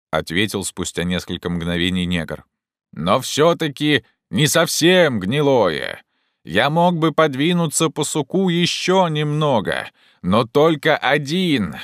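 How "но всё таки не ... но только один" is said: slow, high, carrying voice; calling down. Acted. Jupiter offers to go on alone, calling down. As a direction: holler the qualification back down. Climber calls down.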